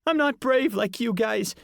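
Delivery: put-on high squeaky boy, deflated; admitting his fear; scared kid. A narrator's reading. Ian pleading.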